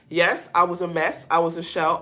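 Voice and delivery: usual speaking voice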